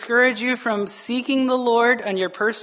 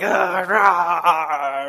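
drawn-out growly "ah, rah"; wordless frustrated roar, played up; mock fleshly growl; 3 semitones lower than usual. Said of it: "it's easy for me to be like".

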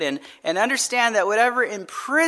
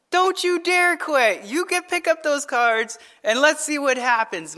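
raised-pitch, firm fatherly order; retold parental scolding; 5 semitones higher than usual. Father telling his son not to quit Uno, acted scolding. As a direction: act out telling off a child.

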